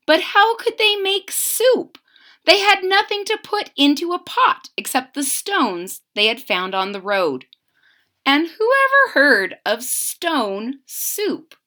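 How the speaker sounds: plain reading voice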